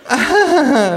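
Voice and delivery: hohes, lautes Fake-Lachen; höflich gekünstelt lachen; künstliches Lachen. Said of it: "lacht die Frau künstlich". (gekünsteltes Partylachen)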